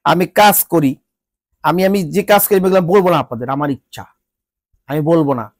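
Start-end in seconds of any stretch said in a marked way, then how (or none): none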